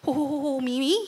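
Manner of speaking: a hint of a stammering Moses; stuttering Moses. Preacher imitating a stammering Moses. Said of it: "Nag-uutal-utal pa si Moses niyan".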